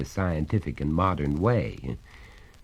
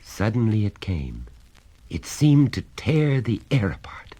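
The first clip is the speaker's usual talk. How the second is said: usual speaking voice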